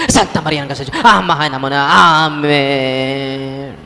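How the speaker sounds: mock rushed prayer. Mocking rushed rosary.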